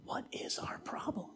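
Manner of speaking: whispered, intimate question. Acted. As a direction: whisper it like a gentle challenge. Softened repeat to congregation.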